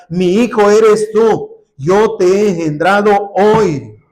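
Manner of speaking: loud, slow proclamation of Mi hijo eres tú; proclaiming scripture. Acted. Preacher proclaiming God's words to the church, loud and slow.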